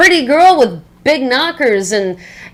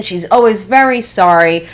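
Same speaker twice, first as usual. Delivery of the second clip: normal talking voice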